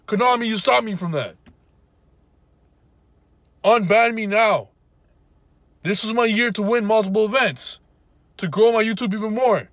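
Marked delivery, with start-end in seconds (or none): none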